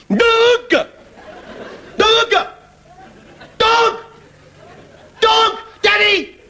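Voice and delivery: put-on high squeaky kid shouting the word; tiny child triumphant at last; high delighted toddler shout; excited child. Child finally reads dog.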